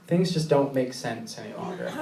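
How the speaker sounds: normal talking voice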